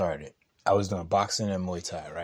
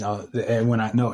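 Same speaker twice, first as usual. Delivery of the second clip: normal talking voice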